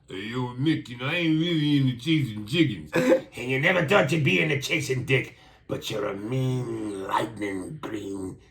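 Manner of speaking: slurred, dragging boxer impression; hammed-up Rocky voice, slowing right down. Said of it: "The way you impersonated Rocky".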